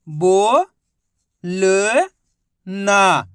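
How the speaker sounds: बो, ल, ना each said alone with a pause between; teacher splitting बो ल ना into single syllables. Shown as a demonstration. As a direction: sound out बो, ल, ना for the class to copy. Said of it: "मेरे तरीके पे गौर करना है".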